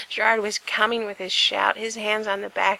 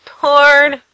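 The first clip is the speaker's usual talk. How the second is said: loud reminder; 6 semitones higher, 16 dB louder than usual. Acted. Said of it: "Frank reminded him".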